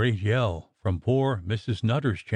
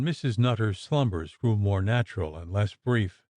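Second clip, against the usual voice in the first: ordinary delivery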